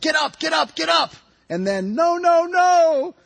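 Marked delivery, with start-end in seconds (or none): 0.0-1.1 high-pitched urgent shouts; crowd shouts. A narrator's reading. Fans to the falling kicker, urgent high shouts. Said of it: "I hear shouts of". 1.9-3.1 high, loud cries of protest; crowd shouts. A narrator's reading. Fans watching the kick fail, high dismayed shouts. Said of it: "I hear shouts of".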